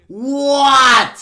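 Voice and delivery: very loud, drawn-out, high yell; shocked shout. Real, not acted. Reacting to a two-second solve, shocked shout. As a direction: explode in stretched-out disbelief.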